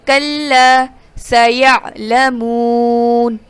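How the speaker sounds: کلا سیعلمون with the long vowel in سیعلمون stretched; slow recitation, elongating the waw madd of سیعلمون; drawn-out madd. Shown as a demonstration. Teacher showing the waw madd, stretching سیعلمون slowly. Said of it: "واو مدہ".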